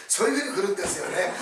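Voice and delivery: ordinary talk, nothing marked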